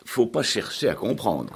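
a lightly voiced giddy young woman, starting high; breezy, careless girl brushing it off; a hint of a silly young typist; Arlette's reply. A narrator's reading. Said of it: "répondit-elle".

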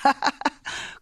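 short high burst of laughter; mocking laugh. Laughing at the threat of a penance, mocking laugh. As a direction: laugh in the other's face, high and quick.

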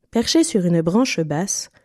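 usual speaking voice

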